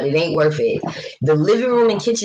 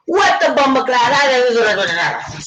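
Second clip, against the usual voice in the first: imitating angry Jamaican cook; 8 semitones higher, much slower than usual. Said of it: "He goes".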